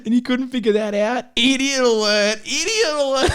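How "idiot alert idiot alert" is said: higher, rising alarm chant while laughing; a silly alarm blaring over the headmistress; mock alarm call. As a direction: sound the idiot alarm. Reading a silly alarm line.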